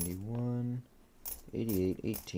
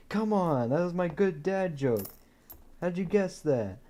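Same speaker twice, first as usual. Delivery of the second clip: exasperated bank teller; 9 semitones higher than usual. Retold or imitated. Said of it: "She's like".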